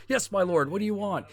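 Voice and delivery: high-pitched servant answering his lord; mock-obedient, pitched well up; eager servant. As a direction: pipe up high in a hammed-up reply. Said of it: "like, in that joke".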